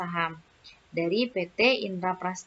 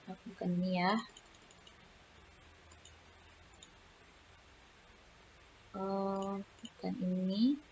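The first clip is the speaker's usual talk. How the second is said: much softer, absent-minded aside while searching; quiet self-talk; 12 dB quieter than usual. Real, not acted. (bicara pelan pada diri sendiri)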